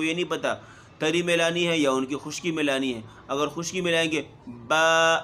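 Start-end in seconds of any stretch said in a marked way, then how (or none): 4.5-5.2 با said with dry lips, the wrong way. Shown as a demonstration. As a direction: demonstrate the faulty با. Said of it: "یہ جو ہے غلط ادا ہوگا". (خشک ہونٹوں سے غلط با)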